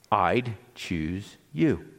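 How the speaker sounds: slow, deliberate words of affirmation; tender blessing. Acted. Preacher modelling a blessing for loved ones, tender and very slow. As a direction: say it slowly, letting each word land.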